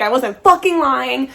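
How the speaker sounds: normal talking voice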